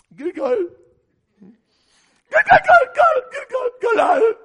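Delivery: put-on very high, loud wailing child's cry; whining child. Preacher mocking Christians as toddlers crying for Gilgal, high shrieking wail.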